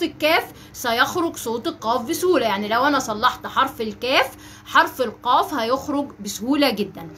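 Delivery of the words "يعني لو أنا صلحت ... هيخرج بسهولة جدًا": normal talking voice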